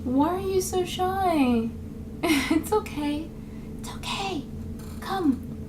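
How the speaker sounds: high, soft, slow mock coaxing; coaxing outsider. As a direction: imitate someone gently luring a shy creature. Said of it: "they tend to be like".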